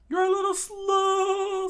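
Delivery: very high, loud, slow scream; shrill mocking scream. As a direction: scream it at him, drawn out. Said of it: "Sanji screamed out".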